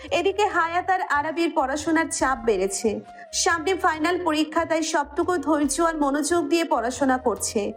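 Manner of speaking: plain reading voice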